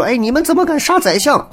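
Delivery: shrill rising protest at the soldiers; indignant challenge. Retold or imitated. The censor confronting the soldiers, in a high outraged voice.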